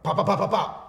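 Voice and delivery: loud, high volley of pops; imitated punches. Man acting out his blows at the airport, loud pops. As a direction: fire off punch noises, loud.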